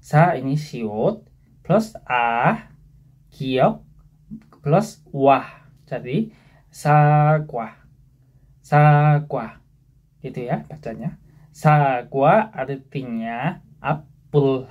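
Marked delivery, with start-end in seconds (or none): 0.0-9.6 very slow, part by part; slow spelling out. Shown as a demonstration. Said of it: "spelling out syllable parts". 11.5-14.7 very slow, "Sagwa" drawn out; slow explanation. Shown as a demonstration. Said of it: "teacher explains word".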